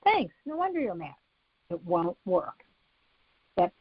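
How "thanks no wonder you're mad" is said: dismissive. Shown as a demonstration. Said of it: "You can't just say".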